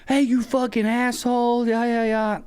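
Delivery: high, slow, drawn-out mock yell; imitated full blast yelling. Old man yelling at the narrator across the parking lot. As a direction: rant like the angry old man. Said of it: "he's yelling at me, like, full blast".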